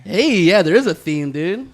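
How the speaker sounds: excited. Real, not acted. Excited reaction to gift theme.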